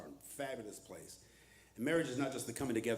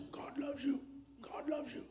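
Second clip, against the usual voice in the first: mock singsong; 12 semitones higher, 9 dB quieter than usual. Mocking childhood teaching.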